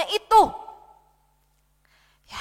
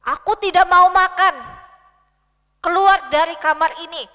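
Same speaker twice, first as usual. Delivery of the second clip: loud, higher, sulky shouting; furious king refusing food, voice raised; angry refusal; 3 semitones higher, 6 dB louder than usual. Acted. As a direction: shout the servants away like a sulking king. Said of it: "Raja Ahab masih marah".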